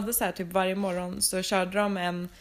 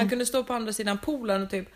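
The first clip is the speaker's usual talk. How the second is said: ordinary delivery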